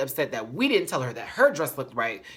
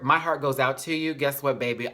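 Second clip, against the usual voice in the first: usual speaking voice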